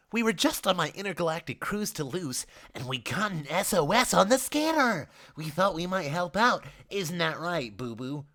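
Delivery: chirpy, upbeat man voicing; bubbly host voice, light and sing-song; cheerful high man's voice. Richard's voice held in the story.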